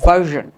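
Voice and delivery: "ভার্সন" as VUR-zhun, buzzed zh; firm, loud model of "ভার্সন" with zh; correct zh pronunciation. Shown as a demonstration. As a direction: say "ভার্সন" with zh, no hard r. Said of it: "উচ্চারণটা একটু খেয়াল করো".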